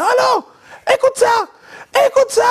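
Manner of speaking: very high excited yell down the phone; high-pitched overexcited phone yell; Rossi shouting. Retold or imitated. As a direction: shriek it like an overjoyed boss. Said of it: "crier, hausser le ton comme ça".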